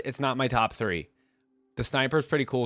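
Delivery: ordinary talk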